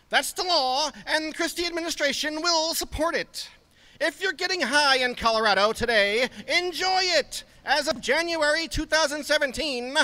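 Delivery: high mocking lawman proclaiming prohibition; Harry Anslinger voice. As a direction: pitch the voice way up and lay down the law like a mock bureaucrat. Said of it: "That's Chris Christie doing Harry Anslinger".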